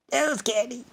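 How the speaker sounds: tiny high put-on voice; mock squeaky voice. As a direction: drop into a small, very high squeak. Punchline in a put-on voice.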